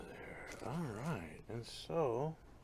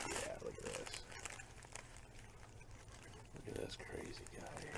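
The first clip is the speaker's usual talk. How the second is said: whisper; 12 semitones lower, 11 dB quieter than usual. Real, not acted. Whispering over plant.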